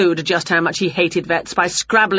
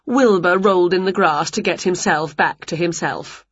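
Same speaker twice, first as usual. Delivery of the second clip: ordinary delivery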